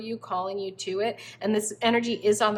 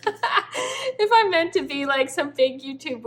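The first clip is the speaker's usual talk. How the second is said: laughing; 7 semitones higher, 4 dB louder, slower than usual. Real, not acted. Laughs mid-sentence.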